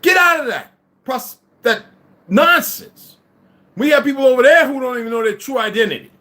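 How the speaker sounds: loud, high and heated; angry shouting. Real, not acted. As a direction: rant at full volume, outraged. Heated rant about false history.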